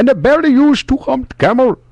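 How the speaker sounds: high mock Indian accent; hammy camel-seller voice. Scam caller bit, Indian voice.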